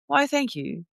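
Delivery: a soft, lightly put-on British English accent. Thanked for the cool accent, in British English. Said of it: "different accents and voices".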